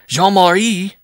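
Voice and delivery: loud, high disbelieving echo of a name; astonished cry. A narrator's reading. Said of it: "the bareback rider cried".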